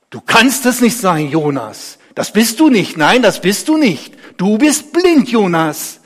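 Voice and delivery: loud, high disbelief; ungläubig. Acted. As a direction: protest that it cannot be. Nachbar glaubt dem Geheilten nicht. (laut und ungläubig abgewehrt)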